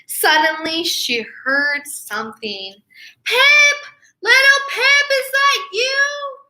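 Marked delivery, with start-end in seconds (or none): 3.2-6.4 high loud searching call. Acted. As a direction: call for the lost chick. Parents calling out.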